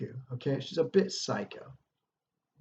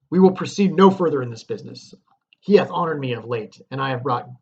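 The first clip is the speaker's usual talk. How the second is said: dramatic play reading; 5 dB louder than usual. Acted. Teacher performs Macbeth and Lady Macbeth's exchange, theatrical reading. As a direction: act out the dialogue with stage force. Reads Macbeth scene dialogue.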